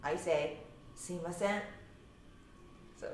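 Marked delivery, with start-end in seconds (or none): 1.0-1.6 Sumimasen in Osaka intonation, rising on the final sen; Osaka-style Sumimasen, lifting at the end; Osaka accent. Shown as a demonstration. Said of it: "mine is accent is on the very last sen part".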